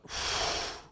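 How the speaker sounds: long, audible blown-out breath; blowing out. Shown as a demonstration. Teacher showing the breathing exercise, long audible exhale. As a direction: blow the air out in one long stream. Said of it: "you blow out".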